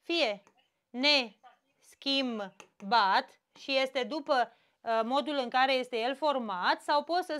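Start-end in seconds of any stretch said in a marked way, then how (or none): 0.9-2.5 neschimbat drawn out slowly, ne-schim-bat; split into syllables. Shown as a demonstration. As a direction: spell out neschimbat by its parts. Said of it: "Cum îl despart?". (neschimbat, rar, pe silabe)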